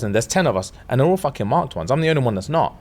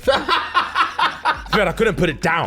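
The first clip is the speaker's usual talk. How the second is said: laughing; 3 semitones higher, slower than usual. Real, not acted. Telling friend about reading late.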